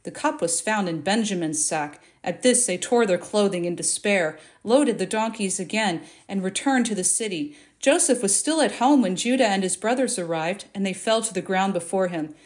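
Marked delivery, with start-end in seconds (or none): none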